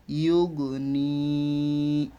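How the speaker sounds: ইউগ নি with নি held very long; drawn-out vowel. Shown as a demonstration. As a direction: echo the g, then stretch নি right out. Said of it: "যেখানে টান দিতে হয় শুধু টান দিলাম". (ইউগ নি, নি-তে লম্বা টান)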